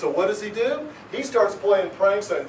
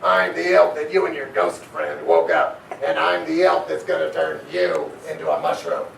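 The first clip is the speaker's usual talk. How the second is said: put-on lower, grumpy old elf voice; irritated elf; 5 semitones lower than usual. Woken old elf tells the kids who he is, irritated. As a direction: snap it like a cranky old elf. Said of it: "he said in irritation".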